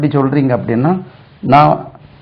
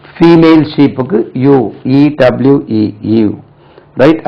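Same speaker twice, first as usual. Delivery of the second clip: ordinary talk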